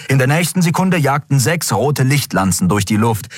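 ordinary delivery

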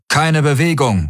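understated battle yell; mild bellow. A narrator's reading. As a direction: order them to freeze, without full shouting. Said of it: "Sie brüllten".

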